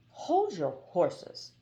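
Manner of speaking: slow, calming genie; slow him down gently; slow soothing. Acted. Genie calms Haroun.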